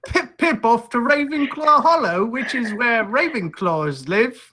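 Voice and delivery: jolly mock-English chatter; British accent. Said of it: "Yeah, just like that".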